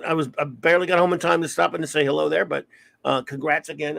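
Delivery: ordinary delivery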